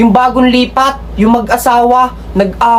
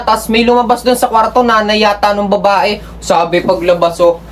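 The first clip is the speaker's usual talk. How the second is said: ordinary talk, nothing marked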